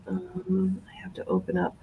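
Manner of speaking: soft unvoiced whisper while searching; whispering to herself. Real, not acted. Speaker searching for the video, whispering to herself. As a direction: whisper it under your breath, busy with the screen.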